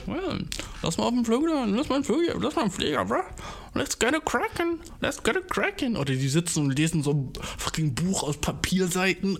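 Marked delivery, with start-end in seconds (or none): 0.1-6.0 higher-pitched mock Aussie bro; laid-back Aussie dude. Australians at the airport, mocked in a higher, chill voice. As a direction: ham up a chill surfer keen to board. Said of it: "Australier sind immer so".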